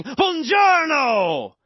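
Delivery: big, long shouted Italian greeting, pitched high; really loud, exaggerated Italian. Shown as a demonstration. Learner repeating Italian tapes in the car, really loud and exaggerated. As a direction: belt it out like a passionate movie Italian. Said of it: "I would say it really loud".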